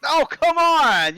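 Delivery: high, drawn-out exasperated cry; loud protest. Real, not acted. Player to game master cutting off the parley, loudly protesting. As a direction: wail it in protest, loud and stretched.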